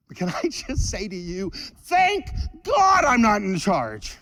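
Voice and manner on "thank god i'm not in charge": slow, louder, higher proclamation; emphatic shout. Real, not acted. Preacher shouting thanks to the congregation, slow and loud. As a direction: cry it out to the congregation.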